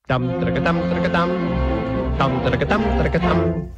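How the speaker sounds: measured tongued tattoo; trumpet imitation. Trumpet declares his love, tongued fanfare imitation. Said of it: "But the trumpet says".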